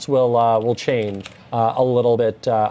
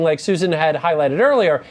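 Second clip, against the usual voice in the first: ordinary talk, nothing marked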